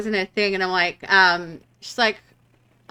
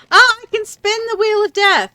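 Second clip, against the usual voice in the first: much higher, louder sudden realization; excited discovery; 12 semitones higher, 7 dB louder than usual. Real, not acted. Streamer finds a new option mid-game, excited discovery. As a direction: burst out with a high, loud discovery.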